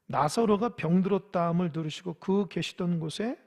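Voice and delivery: ordinary talk, nothing marked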